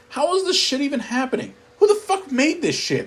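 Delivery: ordinary delivery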